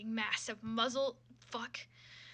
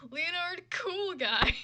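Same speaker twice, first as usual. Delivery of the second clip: Leonard Cool Guy drawn out slow and higher, Cool stressed with a falling tune; savoring the name; 6 semitones higher, 4 dB louder, much slower than usual. Shown as a demonstration. Reader savoring a silly character name, slow and stressed. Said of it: "incredible name".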